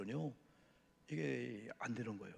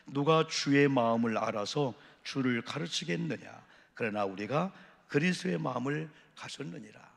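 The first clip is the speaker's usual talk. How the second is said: louder, slow proclaimed scripture; loud slow reading; 8 dB louder than usual. Acted. Pastor leading the congregation in reading the verse, loud and slow. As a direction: declaim each word slowly.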